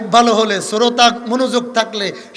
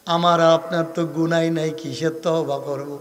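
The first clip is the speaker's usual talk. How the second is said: ordinary talk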